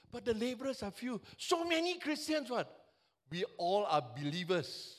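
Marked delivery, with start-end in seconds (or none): none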